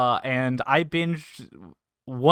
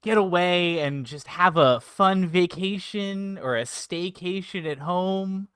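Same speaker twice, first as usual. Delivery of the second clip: slow, pitchy salesman lines climbing higher; infomercial pitch; 4 semitones higher than usual. Acted. [name] keeps the infomercial sell going. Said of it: "Is this an infomercial?".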